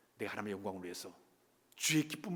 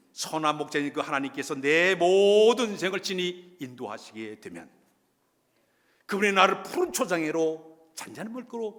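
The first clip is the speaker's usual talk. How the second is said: impassioned preaching; 7 semitones higher, 8 dB louder than usual. Acted. Preaching at full force.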